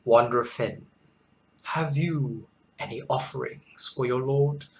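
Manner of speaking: lightly played lordly king; a hint of a king addressing a subject, with measured pauses; kingly. Joking lord asking Wanderer Fen for offerings, mock kingly. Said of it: "one of my kingly moods".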